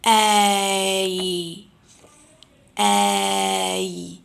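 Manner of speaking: a long, slow A sound, tongue sliding back; drawn-out A diphthong. Shown as a demonstration. Teacher modelling a long A vowel. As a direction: model the A vowel slowly for the class. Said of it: "Repeat after me".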